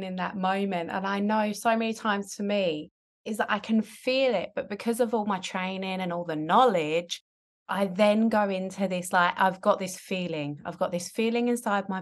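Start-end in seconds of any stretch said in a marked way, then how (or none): none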